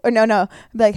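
normal talking voice

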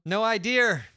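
usual speaking voice

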